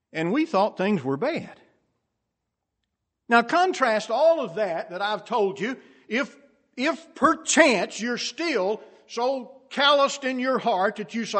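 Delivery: loud, high preacher's challenge; impassioned preaching. Real, not acted. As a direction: press the congregation hard. Preaches at full force.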